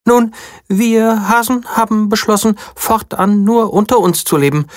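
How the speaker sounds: high small bunny; little bunny voice. A narrator's reading. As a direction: speak like a proud little guard bunny. Bunny explains the wall.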